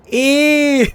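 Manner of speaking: a sudden high, loud disgusted Ew; disgusted squeal. Real, not acted. Reacting to the word British, high loud disgusted squeal. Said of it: "You're being mean to British people".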